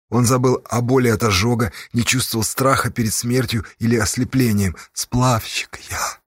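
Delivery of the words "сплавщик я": slow, toneless whisper; voiceless. A narrator's reading. Dazed captive raftsman answers the gang leader, voiceless. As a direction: answer with no voice left, slowly. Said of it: "без голоса ответила Сташа".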